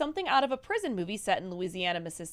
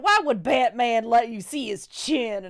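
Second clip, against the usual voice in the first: mock scolding; 6 dB louder, slower than usual. Joking role as guard.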